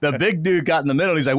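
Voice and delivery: ordinary delivery, nothing marked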